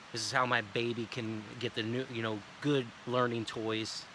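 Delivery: low, soft, sincere pitch; real serious. Shown as a demonstration. Salesman earnestly telling the family whom he provides for. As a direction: speak from the heart, voice dropped low. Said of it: "I get real serious".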